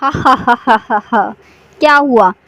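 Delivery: slow, drawn-out witch cackle into a taunt; cackle, then mock the frightened class; laughing. Witch laughing at the silent class, slow taunting cackle. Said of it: "चुड़ैल जोर से हंसते हुए बोलती है".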